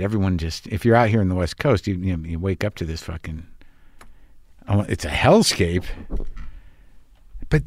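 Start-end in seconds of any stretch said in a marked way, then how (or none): none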